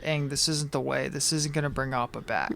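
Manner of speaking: soft, hushed imitated plea; gentle dissuasion. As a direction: talk someone down very quietly. Katara calms Aang down.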